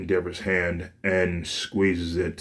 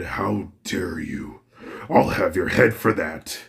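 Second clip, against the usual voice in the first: a lightly voiced furious father; Endeavor threatening. Father furious after being hurt.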